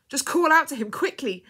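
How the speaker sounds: loud, high, insistent; urging. A narrator's reading. Woman coaching the dying girl, urging loudly. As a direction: press her to act now. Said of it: "urged Missus Beloved of the King".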